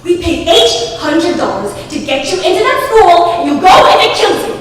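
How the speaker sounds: loud furious scolding; mother yelling at her child over school; enraged mother. Acted. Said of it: "an enraged woman said".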